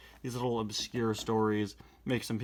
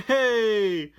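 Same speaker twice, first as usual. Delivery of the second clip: laughing hey; 9 semitones higher, 10 dB louder than usual. Real, not acted. Laughing exclamation.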